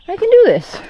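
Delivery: high, loud self-encouragement; determined. Real, not acted. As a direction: cheer yourself on. Pumping himself up for hike.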